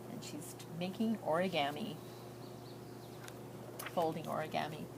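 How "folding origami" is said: usual speaking voice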